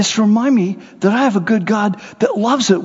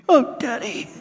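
put-on little girl; 7 semitones higher, 3 dB quieter than usual. Little girl voiced in story.

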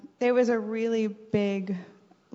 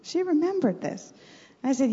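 ordinary talk, nothing marked

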